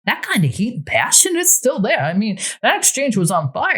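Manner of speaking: a hint of a wondering new boyfriend; lightly voiced Tony.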